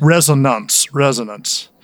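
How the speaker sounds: put-on deep Southern accent. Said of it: "please give us your deep Southern accent".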